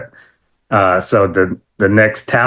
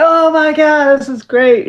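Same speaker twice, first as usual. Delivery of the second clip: much higher fan excitement; thrilled; slower than usual. Retold or imitated. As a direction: gush with joy.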